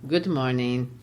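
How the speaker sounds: put-on low, soft German-accented "Good morning". Teacher voicing Gessler saying goodbye in a German accent. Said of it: "I'll practice my broken German English".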